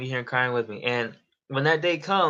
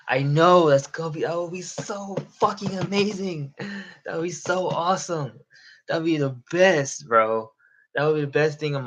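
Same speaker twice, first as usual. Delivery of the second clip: higher, gushing with excitement; excited daydreaming; 5 semitones higher than usual. Real, not acted. Streamer pictures hundreds coming to his live, excited gushing. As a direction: gush over a big dream. Imagining his dream livestream crowd.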